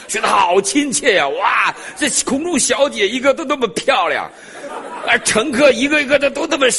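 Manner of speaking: high, loud and laughing with delight; thrilled. Retold or imitated. Preacher recalling his first Chinese flight, laughing delight. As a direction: gush over the friendly plane.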